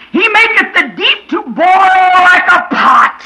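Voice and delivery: high, loud, slow chanted cry; full-force scripture preaching. Acted. Preacher crying out the Leviathan verses to the church. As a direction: ring each verse out over the church.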